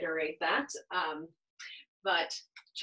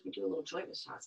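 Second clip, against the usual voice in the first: ordinary delivery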